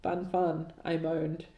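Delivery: ordinary talk, nothing marked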